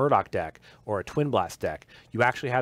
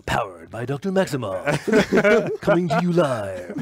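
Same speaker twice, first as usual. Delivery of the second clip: announcer voice; 3 semitones higher, 5 dB louder, slower than usual. Pretend. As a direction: drop the pitch at the end, big. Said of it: "it's gotta go down at the end".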